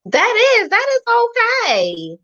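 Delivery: high, slow delighted yes; excited approval. Real, not acted. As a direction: sound thrilled to agree. Cheerful approval of giveaway.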